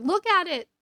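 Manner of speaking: urgent, mock-excited "look at it"; urgent excited exclamation. Friend urgently showing an article.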